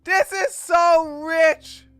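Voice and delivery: high, loud laughing shout; scoffing at a streamer's hypocrisy, laughing shout; laughing outrage. Real, not acted. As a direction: cackle it out in disbelief. Streamer reacts to Nick's hypocrisy.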